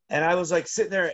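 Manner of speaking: ordinary talk, nothing marked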